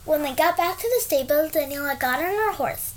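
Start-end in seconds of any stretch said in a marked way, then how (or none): none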